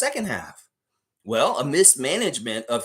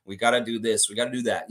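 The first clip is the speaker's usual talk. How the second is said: lifeless locker room talk; 7 semitones lower than usual. Retold or imitated. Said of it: "He comes in the locker room and says".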